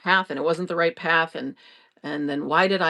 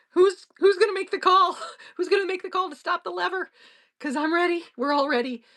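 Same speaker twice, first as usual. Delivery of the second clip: excited eagerness; 10 semitones higher than usual. Retold or imitated. Eager excited imagined call.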